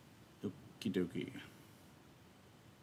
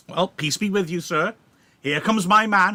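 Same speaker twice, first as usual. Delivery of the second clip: loud, higher, sneering challenge; taunting Tybalt; 7 semitones higher, 7 dB louder than usual. Acted. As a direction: call him out across the square. Tybalt confronts Romeo, reading.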